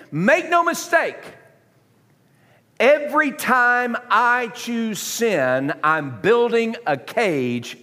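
preacher building in urgency; impassioned preaching. Real, not acted. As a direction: drive it home to the congregation. Preaches at full force.

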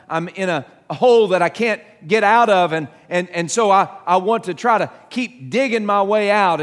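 ordinary talk

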